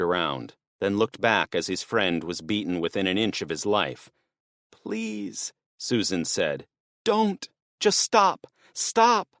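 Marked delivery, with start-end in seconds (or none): 4.7-9.3 much higher, slow, breaking plea; desperate begging. Acted. Susan begging the swordsman to stop. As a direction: beg with everything left.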